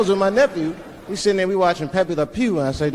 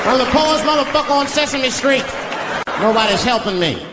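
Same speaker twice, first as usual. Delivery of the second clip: much higher, indignant rant; angry grouch; 9 semitones higher than usual. Acted. Oscar snapping back at the kids, high indignant rant.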